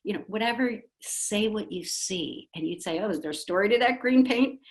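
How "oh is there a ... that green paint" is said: high, quick, interested question; curious invitation. Shown as a demonstration. Parent asking a child about dyed green hair, curious and high. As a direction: invite the story.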